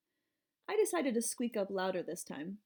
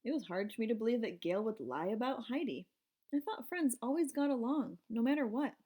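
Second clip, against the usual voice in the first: ordinary delivery, nothing marked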